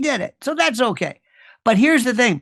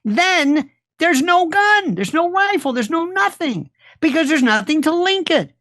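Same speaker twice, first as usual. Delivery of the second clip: mocking disbelief; 7 semitones higher than usual. Real, not acted. Mocking the case, raised pitch.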